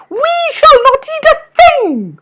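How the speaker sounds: tiny birds protesting; little birds crying. Acted. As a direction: wren children demanding of their father. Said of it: "they cried".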